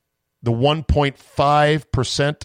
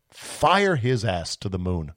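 usual speaking voice

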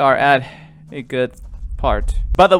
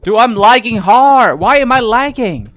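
loud frustrated gamer rant, slow; hyped gamer bro; 8 semitones higher, 9 dB louder than usual. Real, not acted. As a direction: yell at the laggy game. Game lags during chase.

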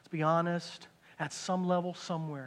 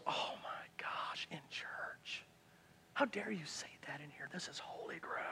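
horrified whisper; 2 semitones lower, 14 dB quieter than usual. Acted. Churchgoer shocked by a confession in church, horrified whisper. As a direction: whisper in mock horror, very soft.

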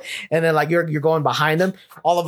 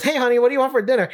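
put-on high, quick, chirpy girlfriend voice; imitated girlfriend; 8 semitones higher, much faster than usual. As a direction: breeze in with a rapid, higher-pitched question. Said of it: "his girlfriend comes in and says".

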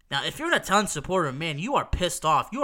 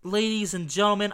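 ordinary delivery

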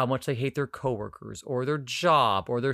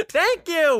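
much higher, excited "Thank you"; emphatic agreement; 14 semitones higher, 4 dB louder than usual. Real, not acted. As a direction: squeal "Thank you" in vindication. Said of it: "repeated agreement takes".

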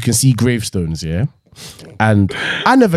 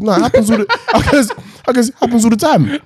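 laughing reply; 11 semitones higher, faster than usual. Retold or imitated. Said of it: "Oh my God".

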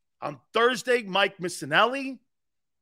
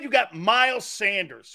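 ordinary talk

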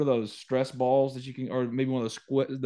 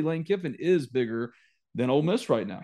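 usual speaking voice

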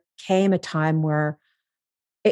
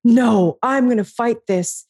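louder, higher "No" in mock defiance; huffy defiance; 5 semitones higher, 4 dB louder than usual. Replaying her huffy answer to the therapist, hammed-up defiance. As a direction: puff up and refuse. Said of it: "I got all huffy and puffy".

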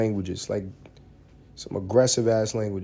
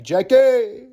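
high, loud cry of the name; mock ecstatic cry; 12 semitones higher, 6 dB louder than usual. Comedian imitating stepfather adoring his idol, mock cry. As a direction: call out the name high and drawn-out.

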